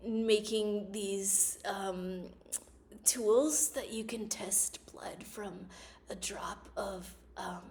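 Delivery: hushed, slow, breathy low mock pitch; a soft, slow put-on voice, partly whispered; Elizabeth Holmes voice. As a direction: imitate her put-on low voice softly. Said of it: "she lowered her voice so she sounded, like, more masculine".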